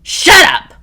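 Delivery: high loud furious yell; a full angry shout. A narrator's reading. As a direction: scream her into silence. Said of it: "Nina yells".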